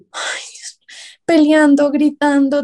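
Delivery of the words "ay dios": breathy whispered "Ay, Dios" to herself; whispered sigh. Retold or imitated. Call-center agent sighing to herself, whispered. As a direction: whisper it in despair. Exasperated sigh under stress.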